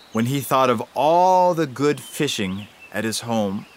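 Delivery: usual speaking voice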